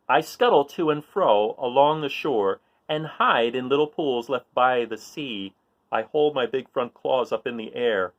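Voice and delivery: lively read-aloud voice for a picture book; reading a riddle book aloud. A narrator's reading. Reading a riddle page to children, playful read-aloud.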